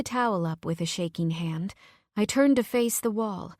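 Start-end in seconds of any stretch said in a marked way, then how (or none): none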